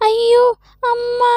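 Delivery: high crying yelp as she falls; pained cry. Acted. Old woman falls in pit. (விழும்போது உயர்ந்த அலறல்)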